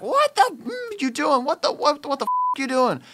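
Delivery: very high shrieking mock tirade; furious tirade. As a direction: screech at them like an enraged boss. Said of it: "she's like".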